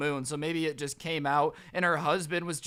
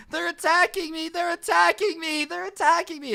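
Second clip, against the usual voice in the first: much higher, louder shrill cry of attacking; imitating a woman crying attack; mock screaming; 12 semitones higher, 5 dB louder than usual. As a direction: wail it like a fake victim. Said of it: "watching this lady scream".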